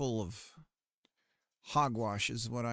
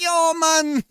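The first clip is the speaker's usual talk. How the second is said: much higher, louder fake Jamaican patois; mock Jamaican accent; 13 dB louder than usual. Streamer retells Snow pitching a reggae album, hammed-up Jamaican accent. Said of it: "He's like".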